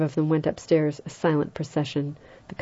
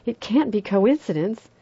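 frightened cry; 6 semitones higher than usual. A narrator's reading. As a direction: cry out in fear. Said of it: "Vera cried".

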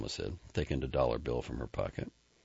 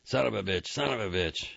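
breathless, raised voice between kisses; pitched-up gasping curse; heated cursing; 6 semitones higher than usual. Acted. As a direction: swear it out in a rush of passion.

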